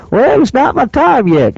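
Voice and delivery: high, slow hammed-up Southern drawl; Hillbilly Jim Southern accent. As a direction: drag it out like an old Southern wrestler. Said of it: "He's got a deep Southern accent".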